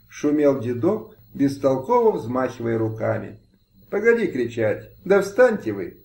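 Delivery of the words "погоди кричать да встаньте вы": a higher, sharp scolding from the lady; lightly voiced angry landowner. A narrator's reading. Landowner scolds kneeling peasants, higher-pitched angry rebuke. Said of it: "рассердилась пани Мыльская".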